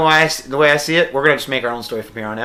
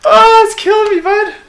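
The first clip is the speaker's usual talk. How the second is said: high, breathless from laughing; 7 dB louder than usual. Real, not acted. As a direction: still cracking up. After laughing hard.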